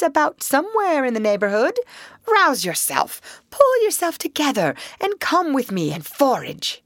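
high, brisk chiding; reproachful. Acted. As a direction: chide him back into action. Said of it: "said the Rat reproachfully".

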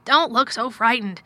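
loud, emphatic urging; exclaimed. A narrator's reading. Guardian to his anxious ward, loud exclamation. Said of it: "he exclaimed".

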